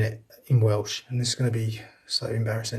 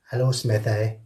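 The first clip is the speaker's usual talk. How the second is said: ordinary delivery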